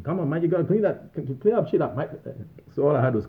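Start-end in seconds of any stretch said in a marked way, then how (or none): 0.0-2.1 garbled Aussie boss, pitched high; Aussie supervisor accent. Retold or imitated. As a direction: imitate a barely understandable Aussie supervisor in a higher voice. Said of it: "got a grasp of the Aussie accent".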